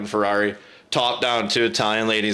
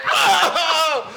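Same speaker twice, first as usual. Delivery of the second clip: high, laughing holler; excited whoop; 3 dB louder than usual. Retold or imitated. Teen celebrating with buddies, excited whoop.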